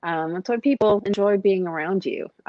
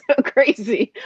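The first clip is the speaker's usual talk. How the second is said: laughing through it, higher and louder, slow; 9 semitones higher, 6 dB louder, slower than usual. Real, not acted. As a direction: laugh out the words. Laughing at husband's wild past.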